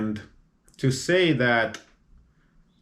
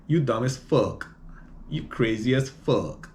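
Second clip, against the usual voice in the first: a hint of newer street slang, higher; newer slang accent; 3 semitones higher than usual. Podcaster imitating today's slang accent. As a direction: imitate how kids say it now. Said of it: "And now it's like".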